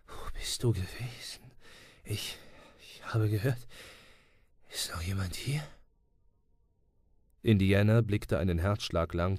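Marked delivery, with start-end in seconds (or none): 0.1-5.6 soft, faltering voice of a wounded man; softer, halting question; weak and faint. A narrator's reading. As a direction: stammer it out, hardly any strength. Dying friend speaks weakly.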